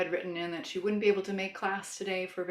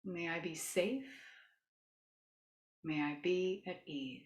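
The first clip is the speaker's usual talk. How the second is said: soothing yoga teacher. Shown as a demonstration. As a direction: gently lead a loving kindness meditation. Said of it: "as I start to use my yoga voice".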